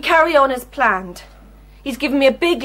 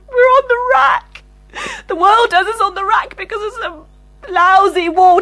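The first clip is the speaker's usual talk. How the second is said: tearful outburst; 6 semitones higher, 4 dB louder than usual. Acted. Tearful outburst about inflation.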